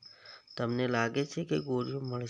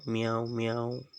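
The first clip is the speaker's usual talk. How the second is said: cat meow. Shown as a demonstration. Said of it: "બિલાડી બોલાવો".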